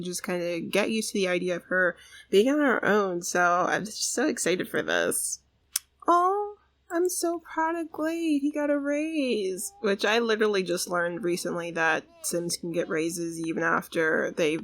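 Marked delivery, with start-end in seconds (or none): 6.1-9.7 proud coo. Real, not acted. Cooing over her Sim.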